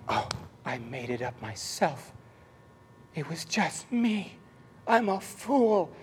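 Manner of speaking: slow, soft mock shame; sheepish self-reproach, drawn out softly; acted embarrassment. Retelling his first try at tongues, acted embarrassment. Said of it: "instantly I'm blushed with embarrassment".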